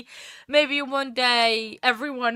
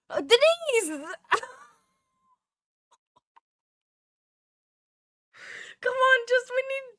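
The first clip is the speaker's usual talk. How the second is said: high excited urging; 13 semitones higher than usual. Real, not acted.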